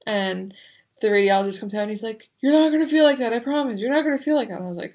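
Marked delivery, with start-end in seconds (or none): 2.4-4.6 loud, high insistent promise; emphatic reassurance. Retold or imitated. Radiologist promising the patient, loud and high.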